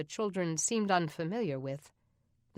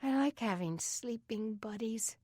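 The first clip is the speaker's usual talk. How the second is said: put-on higher, hushed girl's voice; sleepy young girl; 5 semitones higher than usual. Beth cuddling the little girl in bed, sleepily. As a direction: murmur it like a cosy kid.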